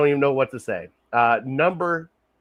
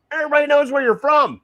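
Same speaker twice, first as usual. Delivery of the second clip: emphatic; 13 semitones higher, 4 dB louder, faster than usual. Real, not acted. Repeating a point for emphasis.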